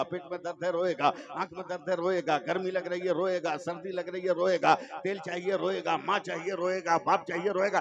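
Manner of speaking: ordinary talk, nothing marked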